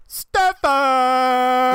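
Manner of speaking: high, drawn-out announcer yell; hammed-up arena announcer calling a player; imitated arena scream. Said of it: "bara skriker sig fram".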